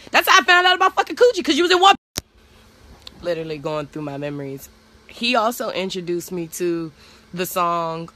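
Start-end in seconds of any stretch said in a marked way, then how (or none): 0.1-1.9 much higher, louder and fast; loud, fast, high-pitched rant; excited outburst. Real, not acted. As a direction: blurt it out in disbelief. Laughing outburst about Coogee sweater.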